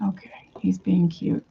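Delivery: hushed aside about the bird; soft whispered remark. Real, not acted. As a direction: drop to a whisper so he won't notice.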